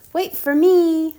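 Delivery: high, loud call after him. Acted. Little sister calls after running brother, loud and high. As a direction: shout after your brother to wait.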